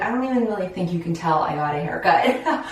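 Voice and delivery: ordinary talk, nothing marked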